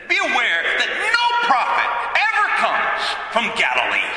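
high, far-off mocking sneer; sneering Pharisee. Pharisees sneering at Nicodemus about Galilee, mock sneer. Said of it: "the Pharisees sneered at Nicodemus".

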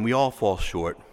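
ordinary talk, nothing marked

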